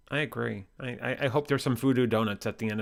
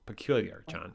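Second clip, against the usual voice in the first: ordinary talk, nothing marked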